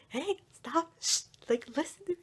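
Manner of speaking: high, urgent retold objection; high-pitched mock exasperation; protesting. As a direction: hush them and demand attention. Scolding laughing father.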